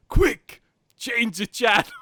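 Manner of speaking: high, loud mock yell of Zangief. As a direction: blurt it in a loud high voice, laughing at the end. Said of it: "Zangief just goes".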